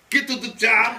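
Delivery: loud, high hammed-up action-hero bark; Arnold Schwarzenegger impression. Bandmate shows off his Schwarzenegger impression, loud and hammy. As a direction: belt it like a muscle-bound movie star. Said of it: "Arnold Schwarzenegger".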